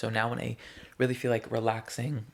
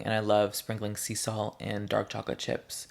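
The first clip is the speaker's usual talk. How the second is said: normal talking voice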